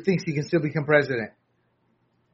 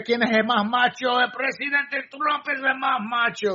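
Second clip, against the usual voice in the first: Spanish hype shout; 8 semitones higher than usual. Pretend. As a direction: hype it up like a rally. Hyped Spanish shout for Latinos.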